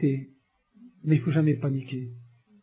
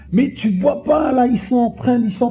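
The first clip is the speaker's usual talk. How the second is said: scared sister; 5 semitones higher, 4 dB louder than usual. Retold or imitated. Frightened sister warns him about the gang, higher. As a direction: voice a panicked sister pointing out danger. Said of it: "une sœur qui me dit".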